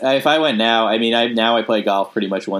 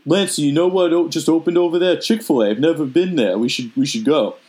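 higher, eager impression of his dad; 6 semitones higher than usual. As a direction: raise the pitch and play his dad. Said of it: "When you do your dad voice".

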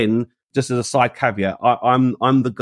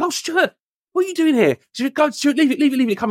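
colleague's high voice; 13 semitones higher than usual. Retold or imitated.

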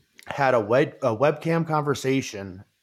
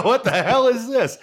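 higher, half-laughing disbelief; laughing shock; 9 semitones higher, 3 dB louder than usual. Pretend. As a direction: blurt it out, laughing in alarm. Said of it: "You go".

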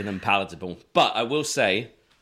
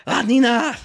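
loud, high French cheer; French accent; 11 dB louder than usual. Retold or imitated. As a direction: greet her like French fans. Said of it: "They'll be like".

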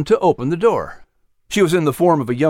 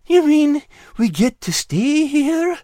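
relieved little creature; slower than usual. Acted. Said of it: "with a voice trembling in relief".

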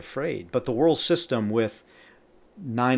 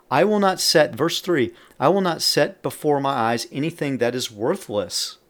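raised, louder psalm reading; scripture proclamation; 4 semitones higher, 6 dB louder than usual. Acted. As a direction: open high and strong, declaiming the verse. Reads psalm verse aloud.

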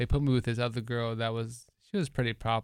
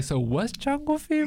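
much higher, innocent daughter voice; high-pitched question; 13 semitones higher than usual. Retold or imitated. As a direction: ask it like a naive grown daughter. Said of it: "she gonna be forty-six, like".

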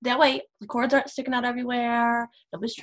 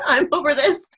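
laughing; 5 semitones higher, 7 dB louder than usual. Retold or imitated. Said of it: "and I'm like".

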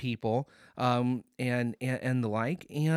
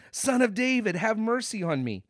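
high, raised cry for mercy; louder imitated shouting; 9 semitones higher, 3 dB louder than usual. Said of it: "He shouts louder".

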